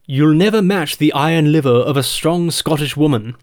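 put-on clipped upper-class English accent, looking down the nose; posh English fop accent. Comic quoting a Brit who claims Scottish roots, in a posh English accent. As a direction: put on a Monty Python aristocrat's English accent. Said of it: "precise elocution of a Monty Python aristocratic fop".

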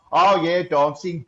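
put-on overdone Brummie accent. Actor recalling a failed casting, overdone Brummie accent. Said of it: "but I totally overdid it".

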